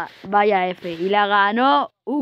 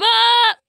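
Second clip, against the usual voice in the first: excited scream; 13 semitones higher, 6 dB louder than usual. Real, not acted.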